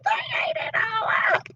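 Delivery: put-on little Baby Bear upset; high squeaky voice. Baby Bear finds her soup gone, squeaky. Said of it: "in her high, squeaky voice".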